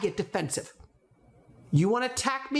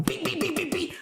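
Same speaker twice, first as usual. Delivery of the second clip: higher beeping sounds like a pushed button; button beeps; 8 semitones higher than usual. Retold or imitated. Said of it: "they're going".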